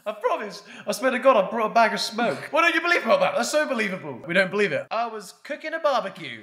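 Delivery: high, pleading mock teen. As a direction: squeal the excuse, overacted. Mock excuse continues.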